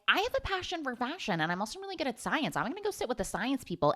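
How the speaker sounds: high, fast mock teen voice; mock Jade. Jade picking the science crowd, in a high fast mock voice. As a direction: rattle it off high and quick. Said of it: "And then Jade is like".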